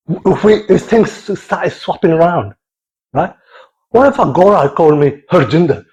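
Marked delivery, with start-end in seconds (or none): none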